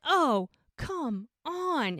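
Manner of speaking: imitated long exasperated complaint; acted exasperation. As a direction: groan it out slowly, fed up.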